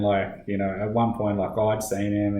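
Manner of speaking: ordinary talk, nothing marked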